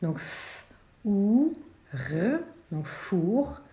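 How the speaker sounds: f breathed, then ou and r stretched slowly; teacher segmenting the word four; sounding out letters. Shown as a demonstration. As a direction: sound out f, ou, r one by one. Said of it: "le son F est fait avec la lettre F".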